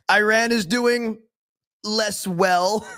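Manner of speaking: giggling parody of the quote; laughing Trump mock. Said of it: "Who talks like this?".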